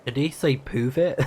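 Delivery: ordinary talk, nothing marked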